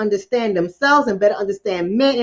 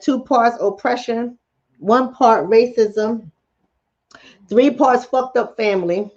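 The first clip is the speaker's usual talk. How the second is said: slow recipe listing; slower than usual. Shown as a demonstration. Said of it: "It's like a recipe".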